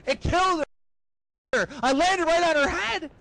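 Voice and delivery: high-pitched, hammed-up distress; anguished lament. Boyfriend telling of crushing girlfriend, high anguished wail. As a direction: cry it out in mock horror.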